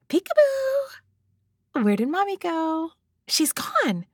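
high drawn-out playful peekaboo; peekaboo baby talk. Pretend.

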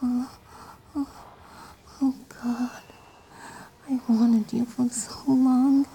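high breathy pretend moans; high moaning. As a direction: gasp it out, high and eager. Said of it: "It would be more like".

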